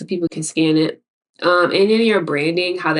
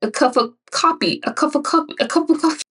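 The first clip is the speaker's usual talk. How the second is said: high, lightly mimicked word mix-up; quoting a TikTok guy; 8 semitones higher than usual. Retelling a TikTok clip of a man tripping on words, mild imitation. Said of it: "He was like".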